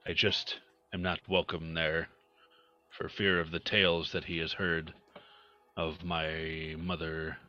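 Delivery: normal talking voice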